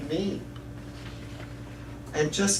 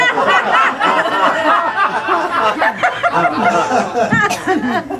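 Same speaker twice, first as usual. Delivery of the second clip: long loud high laughter; real laughter at himself; 12 semitones higher, 7 dB louder than usual. Real, not acted. Laughs at own story.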